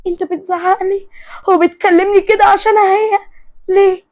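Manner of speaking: high-pitched hurt protest; acted wounded, tearful questioning. A narrator's reading. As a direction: plead as if through tears. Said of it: "حياة بدموع".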